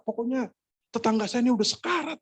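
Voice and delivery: high-pitched urgent report; imitating a worried caller; insistent caller. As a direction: press the emergency in a high voice. Caller insists neighbour is dying.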